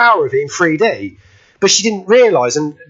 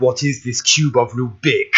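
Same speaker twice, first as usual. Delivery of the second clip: lower, softer movie-villain impression; Boris Karloff; 6 semitones lower, 5 dB quieter than usual. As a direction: put on an old horror star's voice. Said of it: "said it in a Boris Karloff impression".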